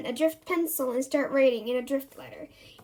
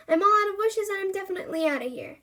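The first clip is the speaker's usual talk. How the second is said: hurried genie; 6 semitones higher, faster than usual. Acted. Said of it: "says the genie".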